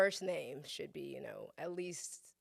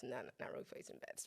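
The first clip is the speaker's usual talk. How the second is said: quick, soft, laughing take-back; laughing aside; 5 semitones lower, 10 dB quieter, faster than usual. Real, not acted. Guest laughingly taking back her joke to the host. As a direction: laugh it off fast under your breath.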